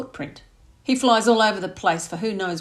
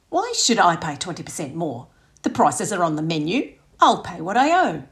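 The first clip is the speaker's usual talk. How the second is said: outraged mother; 7 dB louder than usual. Acted. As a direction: demand an explanation, scandalised. Mum's tipping outrage continues.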